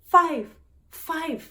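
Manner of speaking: high, loud blurted English word; mock flustered clerk; nervous clerk. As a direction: panicky one-word English. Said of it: "Lo dijo en inglés".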